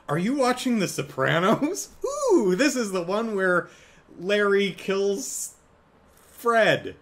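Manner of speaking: high, loud, gushing voice with Larry kills dragged out slow; bubbly fan blurting out the episode; excited gushing. Retold or imitated. Madison gushing to Wong about The Sopranos, high and excited.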